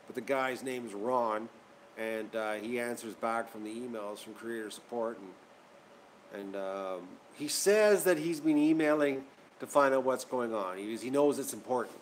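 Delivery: ordinary delivery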